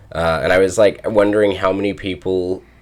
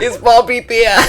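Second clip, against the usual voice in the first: put-on very high laughing Chicago accent; mocking Chicago accent; 4 dB louder than usual. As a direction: squeal it in mock Chicago accent. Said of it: "It's my Chicago accent".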